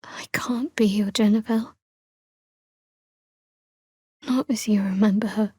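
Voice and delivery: hushed, breathy-free whisper close to the ear. Acted. Companion gently letting a loved one down, whispered. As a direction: whisper it as a painful admission. Tender confession between companions.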